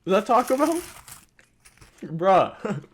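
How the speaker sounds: high, loud, appalled; disgusted disbelief. Real, not acted. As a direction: recoil in horror at it. Disgusted at Taco Bell.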